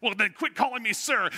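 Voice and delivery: loud, high retort, acting petulant; hammed-up indignant comeback; mock childish comeback. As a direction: snap back like a squabbling kid, played for laughs. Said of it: "I was real grown up".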